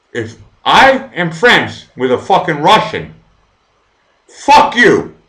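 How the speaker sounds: loud, high, heated yelling; furious shout. Real, not acted. Streamer defending Elena to viewers, furious yelling. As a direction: shout them down, defending a friend.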